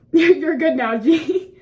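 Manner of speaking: laughing through the words. Retold or imitated. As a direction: chuckle it out. Laughing through imagined reassurance.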